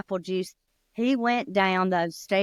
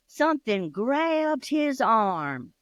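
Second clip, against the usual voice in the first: slow, drawn-out build; suspenseful slow; 4 semitones higher, slower than usual. A narrator's reading. Teller builds dread for listeners, slow and hushed.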